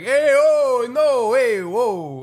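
high, hammed-up Italian-American hollering; mock two Italians finding common ground. Acting fighters who find a shared connection, high Italian-American voice. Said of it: "They always do that".

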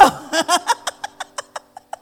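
high, loud laugh; hearty laugh. Real, not acted. Laugh after mum's line.

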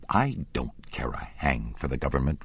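usual speaking voice

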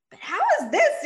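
high, loud shocked question; stunned disbelief. Retold or imitated. Mom staring at a positive pregnancy test, stunned. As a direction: disbelief bursting out.

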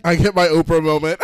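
excited. Real, not acted. Laughing, excited interviewer joke.

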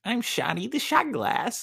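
squeaky high cartoon shot glass; high cartoon mascot introducing himself. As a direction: chirp it like a tiny mascot.

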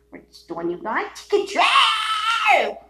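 high, loud ranting shout. Real, not acted. Ranting at the government, a high loud shout. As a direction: explode on the last words.

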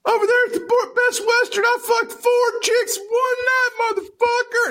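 very high, strained shouting; hammed-up boast. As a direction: holler it like a mock wrestling brag. Hammed-up boast retold as wrestler.

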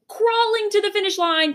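very high, strained climb; high, effortful groan of a line; strained crawling. Acted. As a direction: push it up like straining forward. Exhausted struggling image.

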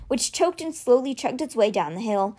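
ordinary delivery, nothing marked

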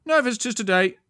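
high, loud guard voice. A narrator's reading. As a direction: turn them away loudly, like a sentry. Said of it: "the guard said".

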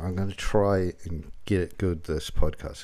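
ordinary talk, nothing marked

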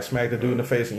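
normal talking voice

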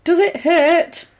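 high, slow mock-questioning voice; imitating torturer. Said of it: "She's like".

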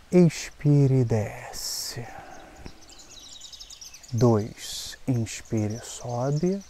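slow, calm breathing guide; slow breath counting. Acted. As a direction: count the breaths slowly and gently. Counted breaths with shoulder rolls.

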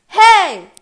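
loud high yell to get attention; shout. A narrator's reading. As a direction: call out loud to the monsters. Percy shouts to furies.

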